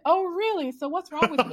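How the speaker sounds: high-pitched, playful mock challenge; skeptical challenge. Author challenging the young woman she just met, skeptical. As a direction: ask it high, daring them to answer. Retold challenge to new acquaintance.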